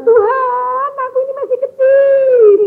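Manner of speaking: high, drawn-out tearful wail; mock crying prayer. Young woman praying to God in tears, exaggerated crying. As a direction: wail it like a sobbing girl pleading. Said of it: "Dia nangis, tanya Tuhan".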